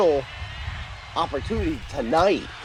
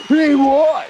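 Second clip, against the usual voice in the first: high, loud mock insistence; mock insistent coworker; 9 semitones higher, 6 dB louder than usual. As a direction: squeak it like a stubborn fan. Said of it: "He goes".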